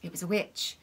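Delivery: plain reading voice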